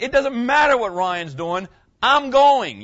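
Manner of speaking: loud, rising to a high, forceful finish; retold yell. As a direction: bark it back, the last words highest and loudest. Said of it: "and I was like".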